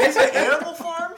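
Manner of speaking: high, loud laughing question; laughing disbelief. Real, not acted. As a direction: squeal it out through laughter.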